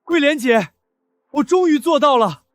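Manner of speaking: high-pitched elated outburst; excited. Acted. Rushing in to tell Guilian of success, excited. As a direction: burst in bursting with news. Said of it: "能让你这么激动".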